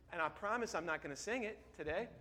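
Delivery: ordinary delivery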